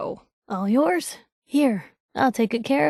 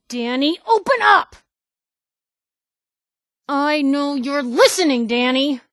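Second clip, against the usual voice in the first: shouting at the gate; 5 semitones higher, 4 dB louder than usual. Acted. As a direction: yell up at the gate, higher and louder.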